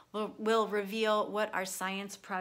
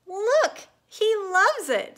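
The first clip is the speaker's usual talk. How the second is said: much higher and louder, thrilled; excited cry; 11 semitones higher, 10 dB louder, much slower than usual. Acted. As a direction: shout it out, delighted it worked. Dog finally eats the smoothie.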